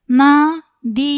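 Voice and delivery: drawn-out, loud first sound, split in two; syllable by syllable. Shown as a demonstration. Teacher sounding out a Hindi word for learners, syllable by syllable. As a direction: sound out the word slowly and loudly.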